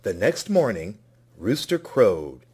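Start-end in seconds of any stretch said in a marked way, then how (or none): none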